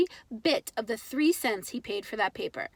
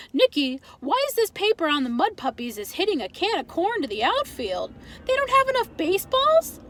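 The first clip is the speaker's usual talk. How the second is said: put-on higher, accented father baffled by slang; puzzled Pop; 7 semitones higher, 3 dB louder than usual. Father asking his son about baseball slang, baffled. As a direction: ask it like the words make no sense.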